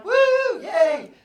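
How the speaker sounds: very high, loud woo; cheering. Retold or imitated. Teacher cheering while jumping up and down, very high and loud. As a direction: let out a joyful whoop.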